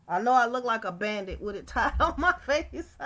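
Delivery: talking through a laugh; amused, laughing at herself. Real, not acted. Laughs at own bandit look.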